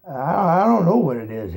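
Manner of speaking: loud, stammering distress; wretched. A narrator's reading. Fiver answering Hazel, loud wretched stammer. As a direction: stammer it out in misery. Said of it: "answered Fiver wretchedly".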